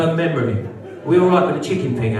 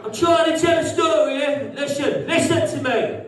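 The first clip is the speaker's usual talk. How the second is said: high, sharp rebuke; telling off a heckler; 9 semitones higher than usual. Real, not acted. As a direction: shut the heckler up.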